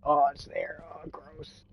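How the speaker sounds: high, hushed cringing groan; soft, high mock disgust at your own accent; self-mocking disgust. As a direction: imitate yourself cringing, tiny high voice. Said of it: "I'm like".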